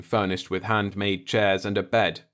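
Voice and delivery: ordinary delivery, nothing marked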